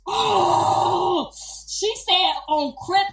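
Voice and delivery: loud, high, crying-out shout; excited shouting. Real, not acted. Hyped retelling the birth.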